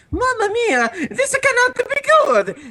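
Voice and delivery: very high, laughing Italian plumber voice; Mario. Acted. Plumber narrating the doomed attack, high and laughing. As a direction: squeal it out like a cartoon plumber. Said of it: "Mario narrated with".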